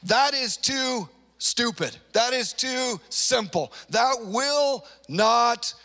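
slow, weighty indignation; slow, emphatic angry dismissal; indignant. Acted. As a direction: spell out each word in angry disbelief. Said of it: "He's angry".

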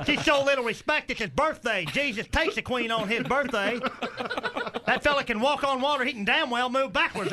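riled-up comic uncle impression; indignant uncle. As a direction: argue back like a stubborn uncle. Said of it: "My uncle cheats".

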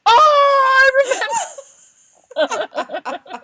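very high, loud drawn-out squeal; hammed-up ecstatic scream of recognition; mock recognition shriek. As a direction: shriek it out through laughter. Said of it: "pulls the other fold and goes".

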